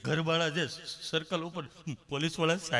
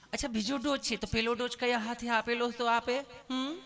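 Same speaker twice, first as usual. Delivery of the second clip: higher, sweetly coaxing nurse voice; put-on sweet nurse; loving nurse; 5 semitones higher than usual. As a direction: ask with exaggerated tenderness. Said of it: "પ્રેમથી પૂછો છો".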